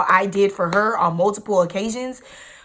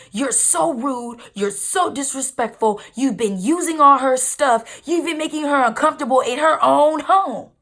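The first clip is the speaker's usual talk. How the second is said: imitating dad's angry rant; 6 semitones higher than usual. Dad yelling at her.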